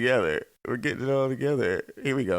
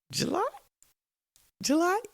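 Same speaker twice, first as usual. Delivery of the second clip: squeaky teasing July, much higher; teasing high question. Pretend. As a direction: tease it up high.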